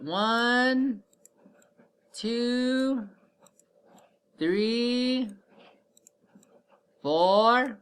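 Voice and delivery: slow high counting. Shown as a demonstration. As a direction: count up slowly for kids. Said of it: "So watch me".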